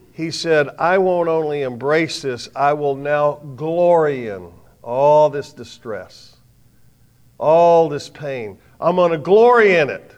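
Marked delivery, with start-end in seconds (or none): 0.8-8.6 slow, swelling preacher's declaration; fervent vow. Acted. Preacher voicing Paul's vow to his congregation, slow and swelling. 8.8-10.1 loud, high climax on glory; triumphant declaration. Acted. Preacher crowning Paul's vow to the church, loud and triumphant.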